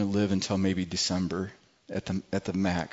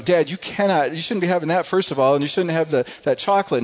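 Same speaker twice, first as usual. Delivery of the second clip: higher, louder motherly scolding; 7 semitones higher, 5 dB louder than usual. Retold or imitated. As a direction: wag a finger at Dad like a worried mom. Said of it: "I'm scolding him like a mother".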